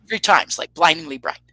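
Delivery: usual speaking voice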